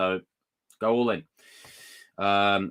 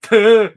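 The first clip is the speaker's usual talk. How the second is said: loud laugh; 12 semitones higher, 15 dB louder than usual. Real, not acted. Laughing at the scene.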